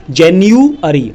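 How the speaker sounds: जनवरी said slowly as jeniuari, English style; English pronunciation. Shown as a demonstration. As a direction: stretch जनवरी out as English January. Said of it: "जिसको बोलते हैं जनवरी".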